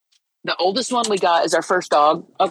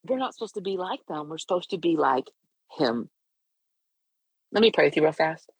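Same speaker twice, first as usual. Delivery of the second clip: normal talking voice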